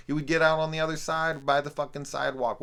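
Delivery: high, loud, rapid exasperation; exasperated rant. Real, not acted. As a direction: rant fast and loud at the absurdity. Ranting about a nonsensical scene.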